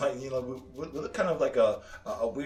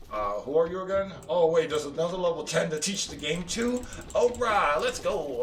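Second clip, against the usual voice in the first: higher, mock-clueless game guide; game imitation; 5 semitones higher, 4 dB louder than usual. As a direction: act out a distracted guide hurrying off. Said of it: "they're kinda like".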